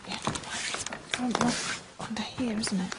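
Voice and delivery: soft unvoiced whisper. Real, not acted. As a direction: keep it to a whisper. Quiet vigil after knocking.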